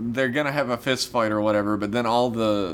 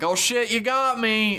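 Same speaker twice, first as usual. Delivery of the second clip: much higher, caught out; high caught squeal; 10 semitones higher than usual. Acted. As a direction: squeak it, caught red-handed. [name]'s caught confession, acted.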